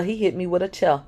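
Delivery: plain reading voice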